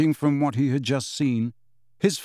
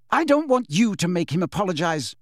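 understated indignant shout, pitched up; 5 semitones higher than usual. A narrator's reading. Lily rounding on James, mild shout. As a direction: protest with raised voice. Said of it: "Lily shouted".

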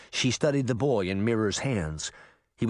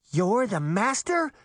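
higher, slow incredulous question; shocked cry, only slightly raised; mild shout; 8 semitones higher than usual. A narrator's reading. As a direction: raise the pitch in shocked disbelief. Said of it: "Daphne shouted".

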